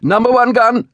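high, loud naval command; shouted order. A narrator's reading. Lieutenant to men running for the gun, shouting. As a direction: shout it to the gun crew, pitched far up. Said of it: "Lieutenant Fennel shouted".

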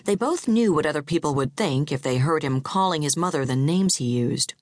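ordinary delivery

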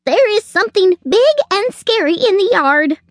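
high, alarmed squirrel; panicked gasp. A narrator's reading. Squirrel warning the chickens of danger, in a panic. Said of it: "gasped the squirrel".

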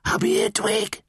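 high, insistent raspy whisper; rasping urgent whisper. Acted. Caged caterbird calling to Twig, rasping urgent whisper. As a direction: rasp it urgently under your breath. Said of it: "he heard a rasping, insistent whisper".

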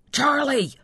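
high-pitched call for a missing brother; mild yell. A narrator's reading. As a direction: call out the name, pitch jumping up. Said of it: "he yelled".

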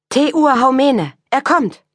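high, loud eager announcement; a boy calls out the news; excited call. A narrator's reading. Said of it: "meldete ein kleiner Junge". (aufgeregter Ruf eines Jungen)

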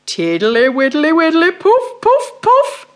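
loud, slow, puffing fat toad. Acted. Mr Jackson blows thistledown about, loud puffing toad voice. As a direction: blow the words out playfully.

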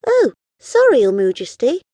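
put-on high apologetic little girl. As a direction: squeak out a sorry. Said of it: "said Pickle".

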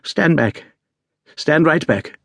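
an ill Holmes snapping a command at his friend; sharp imperiousness. A narrator's reading. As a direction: order him away like it's a crisis. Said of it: "with the sharp imperiousness".

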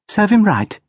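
put-on high, proud, hardened child voice; haughty little girl. A narrator's reading. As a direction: feign indifference with pride. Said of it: "put on her haughty look".

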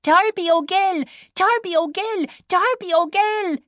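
very high, sing-song shriek; shrill frog chorus. A narrator's reading. Ten thousand frogs taking up the answer, shrill and high. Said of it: "shrieked shrill and high together".

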